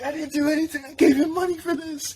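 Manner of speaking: pleading way up high; mock panicked pleading to the cops; high panicked protest.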